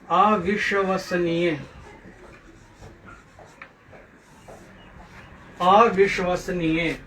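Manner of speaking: अविश्वसनीय slowly, syllable by syllable; careful pronunciation. Shown as a demonstration. Teacher modelling अविश्वसनीय for pupils, slow careful pronunciation. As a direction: stretch out each sound of अविश्वसनीय. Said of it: "इन शब्दों को शुद्ध उच्चारण कीजिए".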